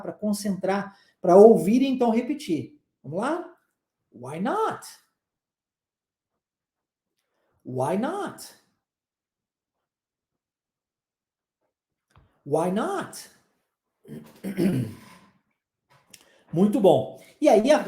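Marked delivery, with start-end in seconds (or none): 4.1-13.3 "Why not?" softly, rising steeply on "not"; question intonation. Shown as a demonstration. Teacher has students listen and repeat "Why not?", question intonation. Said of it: "Entonação é muito importante em inglês".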